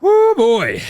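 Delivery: hammed-up executive panic; mock alarm. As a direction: play a nervous team exec. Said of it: "conceivably have gone".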